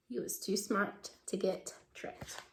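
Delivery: conspiratorial whisper. Real, not acted. Teacher whispering to children about the clever mouse. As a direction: share the clever secret softly.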